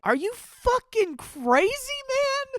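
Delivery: high, slow disbelieving outburst; can't believe the guy did this; incredulous outburst. Real, not acted. Reacting to the Denise lyric texts, high incredulous outburst.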